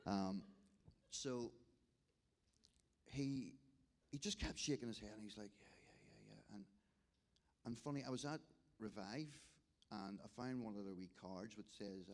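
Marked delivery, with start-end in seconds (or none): 5.6-6.6 whispered yes. Retold or imitated. Broken man nodding, answering softly.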